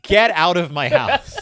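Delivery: loud, half-laughing indignation; mock outrage. Homeowner to pranksters after being fooled, mock-angry and loud.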